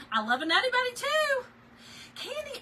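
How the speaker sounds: high, bright read of a viewer's line; high sing-song reading of a chat message; reading a comment. Real, not acted. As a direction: pitch way up to read her words.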